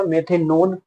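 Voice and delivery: ordinary talk, nothing marked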